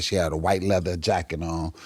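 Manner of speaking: ordinary talk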